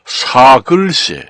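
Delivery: 사글세 said slowly as "sa-geul-se"; slow model pronunciation of 사글세; standard spelling reading. Shown as a demonstration. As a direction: stretch the 사 in 사글세, then geul-se. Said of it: "이렇게 읽어요".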